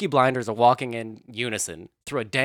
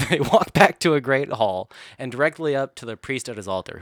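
normal talking voice

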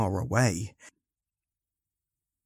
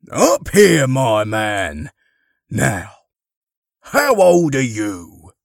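loud, hearty, jolly Santa voice; encouraging Father Christmas; 4 semitones higher, 7 dB louder, slower than usual. Acted. Santa beckons shy Derek, hearty and encouraging. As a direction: boom it encouragingly to a small boy. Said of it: "patted his knee encouragingly".

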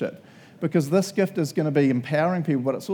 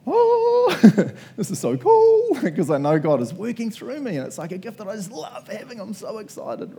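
excited; 6 semitones higher than usual. Retold or imitated. Someone using their spiritual gift, giddy excitement. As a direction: bubble over with joy. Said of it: "they were like".

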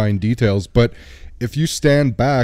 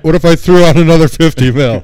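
loud, higher-pitched hammed-up haggling; mock escalating offer; 6 semitones higher, 9 dB louder than usual. Host acting out the casino owner upping his bribe, loud and hammed-up. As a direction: blurt a bigger bid, playing it up loudly.

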